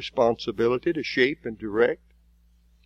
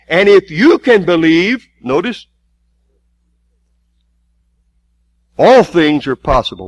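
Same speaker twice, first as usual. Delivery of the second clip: full-force preaching; 5 semitones higher, 6 dB louder than usual. Acted. Preacher driving the congregation toward faith, full force. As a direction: preach it out, hammering each phrase.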